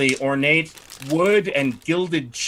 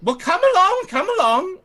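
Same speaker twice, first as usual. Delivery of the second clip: much higher and louder, chirpy come along; high sing-song summons; 4 dB louder than usual. Acted. Lady calling the party up the staircase, high and chirpy. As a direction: beckon the party brightly upstairs. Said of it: "she says".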